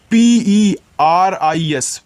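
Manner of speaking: slow, letter by letter; spelling letters out. Shown as a demonstration. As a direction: spell each letter slowly for the children. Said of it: "teacher spells letters for children".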